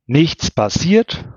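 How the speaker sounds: high, slow, biting disbelief; a slow, rising snarl of disbelief; incredulous hiss. A narrator's reading. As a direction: fling the words back at him, hissing. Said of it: "fauchte Melanie".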